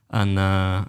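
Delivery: usual speaking voice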